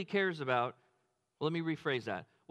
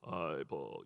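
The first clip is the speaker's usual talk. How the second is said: very low, soft deep-voiced greeting; movie voice guy; 6 dB quieter than usual. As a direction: drop into the deep actor voice from the video. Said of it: "This guy has this voice".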